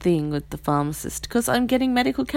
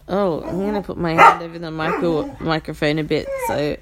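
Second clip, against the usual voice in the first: normal talking voice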